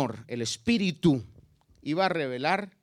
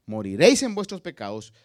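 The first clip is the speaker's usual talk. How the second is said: loud declaration; 7 dB louder than usual. Acted. Preacher quoting Jesus to the religious leaders, loud and firm. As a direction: proclaim it louder, a firm preacher's call.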